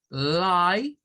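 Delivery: LIE said as a long "lai"; syllable demo. Shown as a demonstration. Teacher marking the first syllable, drawn-out demo. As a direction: hold LIE as one isolated syllable. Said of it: "Lie es una sílaba".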